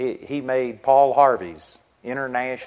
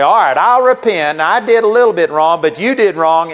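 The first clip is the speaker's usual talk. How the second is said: louder, higher, grudging half-apology; grudging mock apology; 4 semitones higher, 8 dB louder than usual. Mimicking someone who repents while blaming the other, loud and grudging. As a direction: throw the blame back while pretending to repent.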